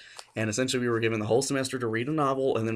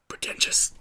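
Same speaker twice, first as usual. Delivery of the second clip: whisper. Real, not acted. Whispered punchline.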